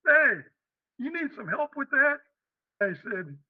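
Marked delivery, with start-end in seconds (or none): 0.1-2.2 high-pitched imitated hail; stranger calling out. Stranger offers help unloading U-Haul, high-voiced call. Stranger calls out in parking lot.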